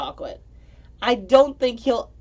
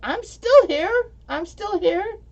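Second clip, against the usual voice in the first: much higher, slow and drawn-out; chat lurker popping in; 12 semitones higher, 4 dB louder, slower than usual. Retold or imitated. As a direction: peek in and announce yourself slowly. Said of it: "pops in and goes".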